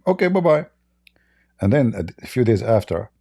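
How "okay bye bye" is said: dismissive. Real, not acted. Dismissive reply to viewer.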